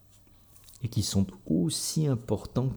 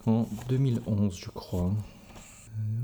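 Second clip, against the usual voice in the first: ordinary delivery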